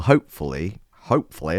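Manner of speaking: slipping into a Northern accent; Northern English accent. Real, not acted. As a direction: say it with accidental Northern vowels. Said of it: "I went into Northern".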